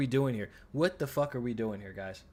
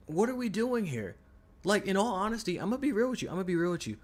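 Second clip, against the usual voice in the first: exasperated; 7 semitones higher, faster than usual. Real, not acted. Streamer ranting about lazy game design.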